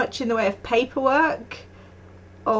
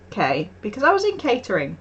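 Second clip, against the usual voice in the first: ordinary talk, nothing marked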